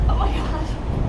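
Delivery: out of breath. Real, not acted. Climbing steep steps.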